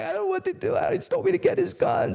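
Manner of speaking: very high, whiny frightened voice; panicked whine. Retold or imitated. Informant frozen by the order, whining very high. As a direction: whimper it out, high and helpless. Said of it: "He's like".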